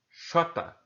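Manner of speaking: XOTA with a SH sound opening the letter name jota; Uruguayan mispronunciation. Shown as a demonstration. Teacher imitating Uruguayans saying the letter J, Xota with initial sh. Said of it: "eles pronunciavam como".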